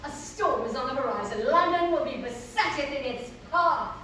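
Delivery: loud, portentous stage declamation; Selina, foreboding. Acted. Selina warning the hero of a coming storm, loudly declaimed. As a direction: proclaim it like a dire warning.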